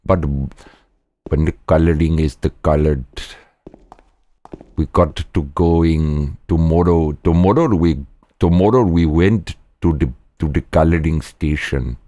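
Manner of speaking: lightly put-on Indian accent; a hint of an Indian accent. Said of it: "You want me to do it?".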